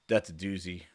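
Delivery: ordinary delivery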